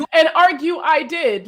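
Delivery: a smug little victory lap; mock-proud. Real, not acted. Wry boast about arguing back.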